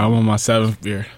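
heavy panting breath; breathing hard. Retold or imitated. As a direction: huff the words out, breathing hard. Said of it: "You breathing hard".

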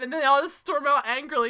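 ordinary talk

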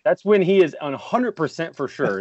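normal talking voice

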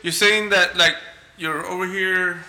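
ordinary delivery

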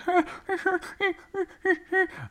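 high yelping dog sound; dog noise. Retold or imitated. Dog greeting its owner, high yelp. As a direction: imitate the excited dog, high. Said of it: "And the dog's like".

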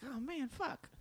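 high, soft dismayed groan. Retold or imitated. Dismayed reaction retold.

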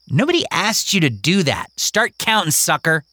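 high, sulky snap; mock-angry kid. Kingston snapping at Mary, high and sulky. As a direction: tell her off, a child's spite.

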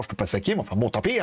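usual speaking voice

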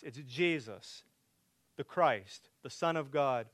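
ordinary talk

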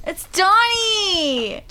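high, drawn-out wailing shout; insistent shout. Pretend. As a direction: cry it out in mock outrage. Mock-insisting on the disputed name.

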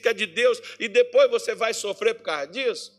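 full-force preaching. Acted.

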